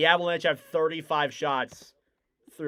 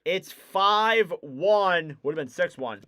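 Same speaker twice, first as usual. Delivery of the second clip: period wrap-up; 4 semitones higher, 4 dB louder than usual. Acted. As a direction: land the end of the period.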